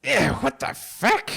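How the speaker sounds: high, annoyed rat yelp; high-pitched burst of rat outrage; startled rat. Acted. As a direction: yelp it like an indignant rat creature. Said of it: "You just hear".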